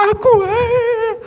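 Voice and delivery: high, slow, tearful plea; mock tearful plea. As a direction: beg to take the pain yourself. Parent offering self instead.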